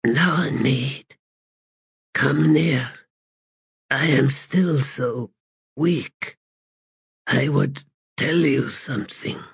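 lightly voiced, frail ancient god; a hint of a weak, dying god. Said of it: "in a frail voice the crippled god replied".

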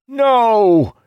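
high, loud, desperate cry; anguished scream. A narrator's reading. Captain seeing his ship doomed, screaming. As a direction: scream in horror. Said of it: "Idara screamed".

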